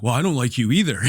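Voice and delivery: mock comeback from a rebuffed rival; changed tone. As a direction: retort in a switched tone, imitated. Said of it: "he just totally changes his tone".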